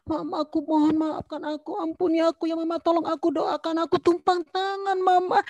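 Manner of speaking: put-on high, pleading child's voice, crying as she begs; imitating a sobbing child. Retold son begs mother for prayer, crying high and pleading. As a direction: plead like a weeping little boy asking forgiveness. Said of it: "dia nangis".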